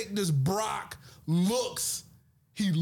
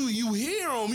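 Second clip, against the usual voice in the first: ordinary talk, nothing marked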